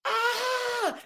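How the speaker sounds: sudden high-pitched scream of shock; reliving the shock of Jesus vanishing, a high scream; retold scream. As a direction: let out a short startled shriek. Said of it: "Now, I screamed".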